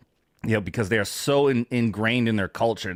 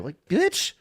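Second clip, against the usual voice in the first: mock indignant rant; 13 semitones higher than usual. Real, not acted. Ranting back at the tour guide.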